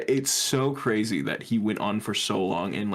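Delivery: usual speaking voice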